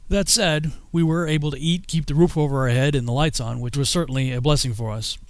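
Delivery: ordinary talk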